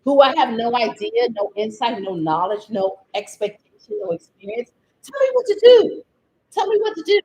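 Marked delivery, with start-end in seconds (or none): 5.0-7.2 higher and louder, demanding; real frustrated plea; exasperated demand. Real, not acted. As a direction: insist at the developers. Scolding product developers.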